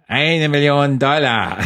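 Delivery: bone-dry cheap villain, even drier. Retold or imitated. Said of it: "noch trockener".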